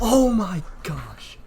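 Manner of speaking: high, slow, loud drawn-out gasp; excited exclamation. Real, not acted. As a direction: stretch it out in disbelief. Level just beaten.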